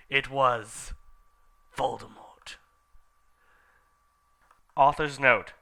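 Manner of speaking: ordinary delivery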